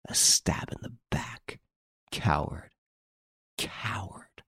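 soft, half-whispered condemnation; hushed accusation. Acted. Accuser condemns a stab in the back, hushed contempt.

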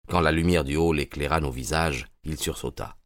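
ordinary delivery

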